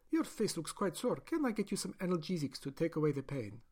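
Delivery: high, bright-pitched bedside greeting; overly cheerful doctor voice; friendly doctor. Acted. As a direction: sweetly welcome the new subject. Doctor greets waking patient.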